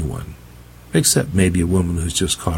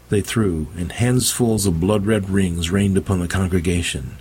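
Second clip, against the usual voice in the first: plain reading voice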